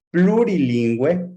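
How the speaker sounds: normal talking voice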